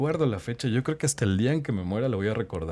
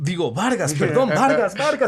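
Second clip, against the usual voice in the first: much higher and louder, hurrying to fix the slip through a laugh; flustered announcer; 10 semitones higher, 7 dB louder than usual. Acted. Rookie announcer correcting a surname on live radio, higher, louder and laughing. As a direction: patch the mistake in a bright radio voice, laughing it off.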